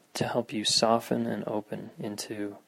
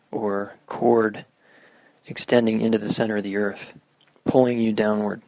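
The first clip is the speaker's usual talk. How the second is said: guided meditation; 5 dB louder than usual. Acted. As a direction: guide listeners through grounding. Leads a meditation.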